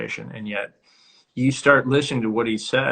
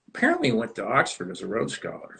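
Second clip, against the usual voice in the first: ordinary talk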